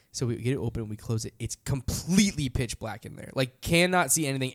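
usual speaking voice

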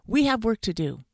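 high, loud reprimand; sharp. A narrator's reading. Dentist to joking fox, sharp. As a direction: cut the joking short, firmly. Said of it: "said the dentist sharply".

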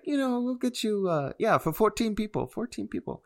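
put-on high-pitched Indian accent. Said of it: "my best take on it".

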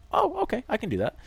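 high squeaky mock agreement; hammed-up high "oh okay"; mock eager. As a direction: pitch way up like an overly willing pushover. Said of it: "I was like".

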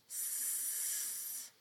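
S- hissed long and unvoiced like a snake; drawn-out s like a snake. Shown as a demonstration. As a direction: hiss the S- like a snake. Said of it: "I sound like a snake".

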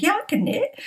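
high, breezy bluff; fake confidence. Asked if she can knit, high bluffing yes. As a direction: chirp it like you mean it though you can't. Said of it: "So I said".

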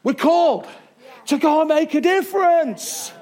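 loud, high preacher's cry; ringing, punched-out call to action; rousing exhortation. Real, not acted. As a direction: proclaim it to the whole room. Rousing climax of the sermon.

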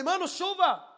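loud, high excited shout; exclaimed alarm. Retold or imitated. Blurting rain to his friend on the plane, exclaimed alarm. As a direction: cry it out in exaggerated alarm. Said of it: "Não, não foi assim".

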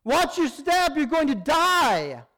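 high, loud, stretched-out cry of alarm; urgent warning shout. Retold or imitated. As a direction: yell to stop someone falling off a roof. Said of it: "an urgent, brief message".

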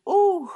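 high, loud swooning whoop; sudden delighted exclamation; overcome "ooh". Retold or imitated.

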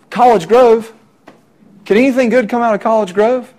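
scornful small-town jab; sarcastic sneer. Retold or imitated. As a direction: sneer at the little one-stoplight town. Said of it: "the sarcasm and the cynicism".

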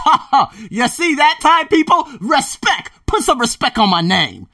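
high, loud, slow mock swagger; cocky boast. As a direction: strut through each word, showing off. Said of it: "and I said".

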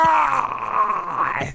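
sharp mock cry of pain, high; retold cry of pain. Husband retelling his wife's cry in labor, mock pained cry. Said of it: "she's like".

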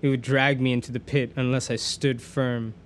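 plain reading voice

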